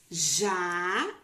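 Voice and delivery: "já" held long and loud; isolated syllable. Shown as a demonstration. Teacher separating a word for pupils, "já" loud and drawn out. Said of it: "separar em sílabas".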